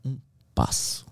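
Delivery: slow whispered "Um passo"; soft echo. Retold or imitated. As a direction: breathe "Um passo" quietly, slowly. ("Um passo" sussurrado e lento)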